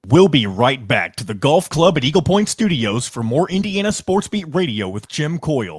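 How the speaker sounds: slow, booming radio announcer; announcer read. Acted. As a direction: sell it like a station promo. Recorded station promo read.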